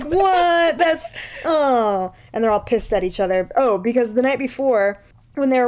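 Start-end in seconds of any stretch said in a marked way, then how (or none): 0.0-2.1 high, loud startled gasp of a reply; hammed-up dismay; mock shock. Friends react to being caught out, mock shocked and loud. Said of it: "they're all like".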